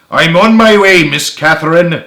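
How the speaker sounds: loud, high, eager Scottish declaration; amateur Scottish voice, ringing out; Scottish accent. Acted. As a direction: announce it in a rough Scottish accent. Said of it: "not the best at doing a Scottish accent".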